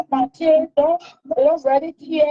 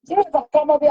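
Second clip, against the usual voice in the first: ordinary talk, nothing marked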